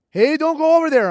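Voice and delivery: a high, loud warning call; urgent warning. Retold or imitated. Interpreter warning the narrator off, high and loud. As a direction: stop him before he walks past the cow. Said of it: "my terp is like".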